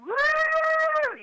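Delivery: high cheering woo like a crowd; mock crowd woo. Comic imitating a cheering crowd, high whoop. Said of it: "that's where the crowd would".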